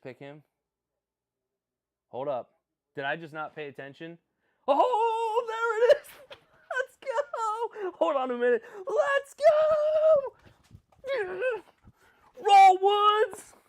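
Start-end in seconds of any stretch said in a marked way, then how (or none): 4.7-7.9 shrieking high with joy, very loud; ecstatic scream. Real, not acted. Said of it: "Sorry, I said I was gonna be unfair". 8.0-8.6 much louder, higher, breathless; can't contain the excitement; excited. Real, not acted. Reacting to his team's pick. 8.9-13.5 full-throated cheer way up high; ecstatic scream. Real, not acted. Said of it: "Sorry, I got excited".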